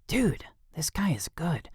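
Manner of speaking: hushed private whisper; whispered thought. Acted. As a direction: breathe it to yourself. Inner thought mid-fight.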